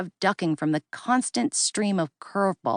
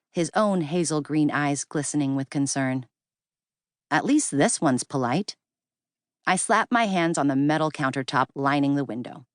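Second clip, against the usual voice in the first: ordinary delivery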